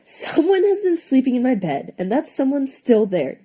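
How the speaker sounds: put-on baby bear squealing about his occupied bed. A narrator's reading. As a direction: squeal it like a small excited cub. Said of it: "And Baby Bear squealed".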